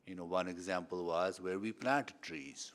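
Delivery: ordinary talk, nothing marked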